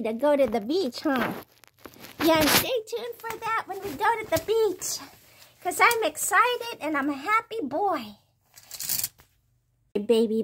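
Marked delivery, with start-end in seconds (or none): 2.2-8.2 high cutesy pet voice; cat's voice. Pretend. Owner speaking as her cat about the beach trip, in a high cutesy pet voice. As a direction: chirp it out as the happy cat.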